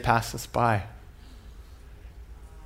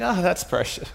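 higher, feigned fond approval; mock sweetness; 6 semitones higher than usual. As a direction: coo it as if charmed. Fake praise covering real opinion.

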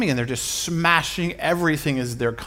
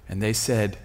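normal talking voice

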